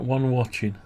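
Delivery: normal talking voice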